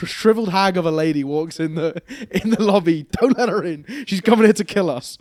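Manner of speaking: loud half-laughing warning. Retold or imitated. Rich warning the receptionist, laughing loudly. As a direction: laugh through a mock-serious warning.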